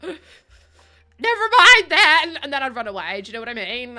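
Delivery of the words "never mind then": mock retreat. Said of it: "I'll be like".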